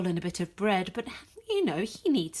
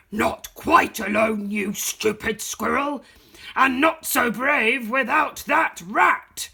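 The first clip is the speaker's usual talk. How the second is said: louder, slow, menacing growl of a voice; menacing dog; 3 semitones higher, 8 dB louder, slower than usual. Acted. Dog Slim taunting the lone squirrel, slow and menacing. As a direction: loom over the squirrel as a bully dog.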